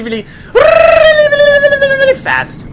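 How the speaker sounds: very high, loud rapid-fire repetition; frantic babble. Pretend. Worker wishing two weeks gone fast, frantic babble. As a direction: trill the word into a squealing blur.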